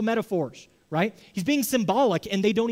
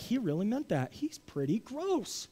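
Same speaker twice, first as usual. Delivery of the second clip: dropped to a hushed, grossed-out aside; mock disgusted hush; 3 semitones higher, 9 dB quieter than usual. Preacher voicing the crowd's disgust at Jesus, hushed and grossed out. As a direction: murmur it like a shocked onlooker. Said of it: "And they're like".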